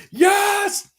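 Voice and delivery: high, loud celebratory shout; excited shout. Real, not acted. Celebrating rival's win, shouting.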